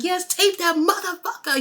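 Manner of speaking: high-pitched imitation of a wrestler going off; mock frenzied cheering; crazed egging-on. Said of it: "He was just going so crazy".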